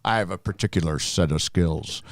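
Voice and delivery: low, soft menacing quote; low menace, movie threat; gravelly movie hero. Acted.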